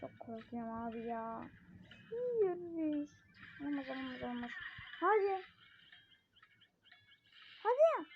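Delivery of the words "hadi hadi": high, loud come on; will it to happen; eager urging. Real, not acted. Gamer cheers the game on, excited.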